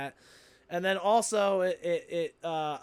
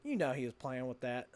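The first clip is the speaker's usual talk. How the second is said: ordinary talk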